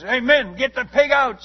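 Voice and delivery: loud, high shouted order; chief shouting. Retold or imitated. Chief ordering his men to remove the pig, loud shout. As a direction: yell it over the screaming crowd. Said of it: "he says".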